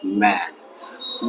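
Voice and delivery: "मैन" as one long, low syllable; "मैन" drawn out without a break; read in one go. Shown as a demonstration. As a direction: say "मैन" softly in a single stretched beat. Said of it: "इसको एक ही बार में हम लोग पढ़ लेंगे".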